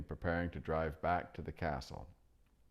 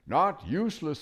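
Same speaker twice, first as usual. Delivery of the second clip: high, louder hooting owl; 11 semitones higher, 6 dB louder than usual. Acted. Owl politely hooting to the deaf dwarf. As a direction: hoot up at a deaf old dwarf.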